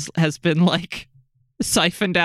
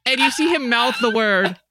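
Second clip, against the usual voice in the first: usual speaking voice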